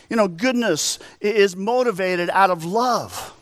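high, rising preacher's appeal at full force; impassioned preaching. Acted. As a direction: lift the congregation with fervent urgency.

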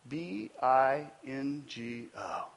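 slow, letter by letter; spelled out. Shown as a demonstration. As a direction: spell it out slowly for effect. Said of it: "spelled out".

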